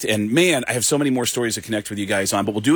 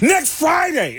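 high, punched imitation; mock-shout it as the comedian, way up high; retold emphatic comedian; slower than usual.

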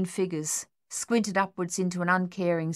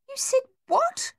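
stunned disbelief; 3 dB louder than usual. Acted. Stunned captain.

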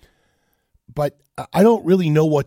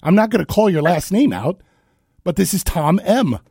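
sharp, loud call-out; angry confronting; 6 semitones higher, 4 dB louder than usual. Real, not acted. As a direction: nail him on air, indignant. Confronting caller on air.

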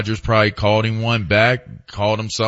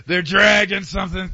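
higher mock-exasperated catchphrase; mimicked exasperated dad; 7 semitones higher than usual. Co-host joking about the team, mock dad exasperation. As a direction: borrow the ranting dad's complaint.

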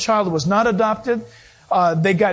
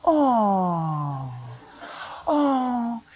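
put-on long, sliding "aww" over a baby; cooing "oh"; 5 dB quieter than usual. Passengers stop in the aisle at the baby, cooing. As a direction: draw out a melting, falling coo.

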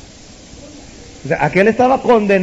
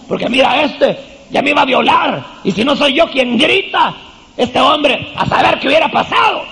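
indignant wife; 6 semitones higher than usual. Retold or imitated. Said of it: "empieza a decirle".

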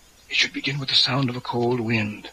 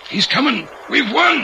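high, rising exultant cry; excited triumph; 12 semitones higher than usual. Acted. Ritual leader to partner as the wind rises, exultant. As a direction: cry out as victory arrives. Believes the horseman is coming.

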